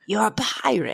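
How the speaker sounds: put-on old Wendy from Hook. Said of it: "the old lady Wendy from Hook".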